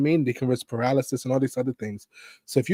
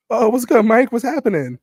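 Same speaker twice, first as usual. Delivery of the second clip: higher, louder overly chipper hello; fake cheery greeting; 9 semitones higher, 6 dB louder than usual. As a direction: greet the boss with forced brightness. Answering boss's call cheerily.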